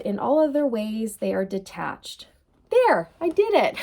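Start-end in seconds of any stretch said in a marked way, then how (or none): 2.7-3.8 high, loud little self-cheer; giggly relief, pitched up; playful triumph. Real, not acted. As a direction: celebrate finally getting the words out. Mock triumph after getting words out.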